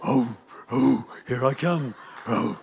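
low, softened monster growl; deep mock-scary chasing voice; playful monster. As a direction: play a dad pretending to be a monster. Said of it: "chase their children around the house like a monster".

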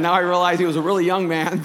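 usual speaking voice

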